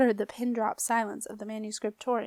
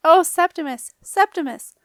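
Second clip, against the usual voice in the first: high loud joyful cries; joyful cry; 6 semitones higher, 10 dB louder than usual. A narrator's reading. Marcia rushing to her returned apprentice, high joyful cries. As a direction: cry out his name in delight.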